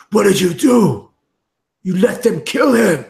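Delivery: high, loud accusation through tears; accusing grief. Acted. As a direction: hurl the blame at her. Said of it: "he says".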